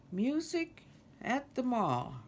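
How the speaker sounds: dismayed moan, falling from high. A narrator's reading. Kermit echoes Lulu's mall news, dismayed moan. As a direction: moan the echo, sliding downward. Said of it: "Kermit moaned".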